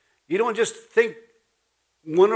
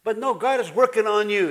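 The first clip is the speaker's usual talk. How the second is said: ordinary talk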